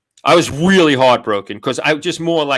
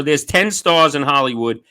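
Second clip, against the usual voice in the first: ordinary talk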